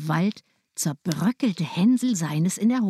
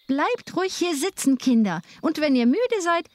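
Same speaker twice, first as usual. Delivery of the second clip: higher, sweet, hollow voice; false kind stepmother; 9 semitones higher than usual. A narrator's reading. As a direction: settle them down before leaving them. Stepmother leaving children.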